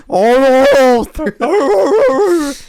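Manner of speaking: squeaky high, loud moan; high mock groan. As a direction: wail it in a silly high voice. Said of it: "And then you're".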